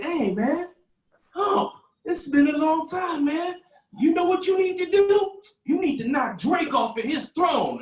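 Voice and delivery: high, loud, slow hype-man voice; hyped fan. Retold or imitated. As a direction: egg him on like an excited fan. Fan urging [name].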